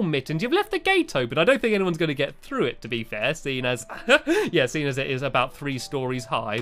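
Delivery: usual speaking voice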